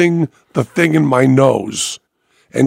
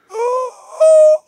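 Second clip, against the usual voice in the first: very high, struggling falsetto squeaks; squeaky damaged voice; slower than usual. Retold or imitated. Diner trying to order from the server, helplessly squeaky. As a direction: imitate a voice that can barely get a word out. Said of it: "that's all I can do".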